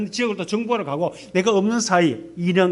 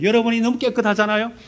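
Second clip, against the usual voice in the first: normal talking voice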